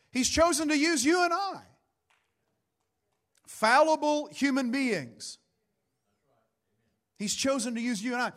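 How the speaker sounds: high, emphatic preacher's declaration; emphatic preaching. Real, not acted. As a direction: drive the point home to the congregation. Preaches with force to congregation.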